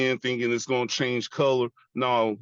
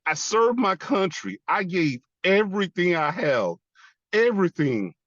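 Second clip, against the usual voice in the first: high, pleading outburst; anguished protest; 6 semitones higher than usual. Retold or imitated. Veteran reminding God of his service, anguished protest. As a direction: insist on it, pitched up with hurt. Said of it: "I yelled at God".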